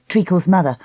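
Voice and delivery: usual speaking voice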